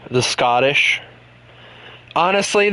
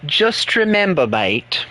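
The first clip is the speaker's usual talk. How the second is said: a light British put-on; a hint of a cheeky English bloke; a streamer needling Chris in a light British put-on; mock British; 7 semitones higher, much slower than usual. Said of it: "mocking the British to Chris".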